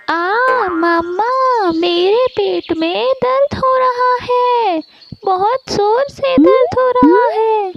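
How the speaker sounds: high-pitched wail of tummy pain; child crying out. A narrator's reading. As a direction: cry out to mother in a child's voice. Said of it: "वो ज़ोरों से चिल्ला उठता".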